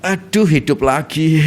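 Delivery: slow, dejected mock groan; weary waking. Reluctant riser faces another morning, acted weary dismay. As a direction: sigh it out as if dreading the day.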